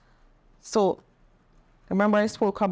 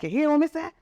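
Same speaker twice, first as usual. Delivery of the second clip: defiant demand; 9 semitones higher, faster than usual. Retold or imitated. To her partner on the phone, defiant and raised. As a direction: throw the question at him like a final warning.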